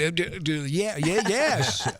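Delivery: mock-flustered eager yes; tongue-tied, rising excited stammer. Flustered reply to attractive women.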